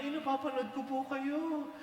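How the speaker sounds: a shopkeeper begging for a photo, high and eager; excited fan. Retold or imitated. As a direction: gush like a starstruck fan. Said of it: "lumapit na at sinabi".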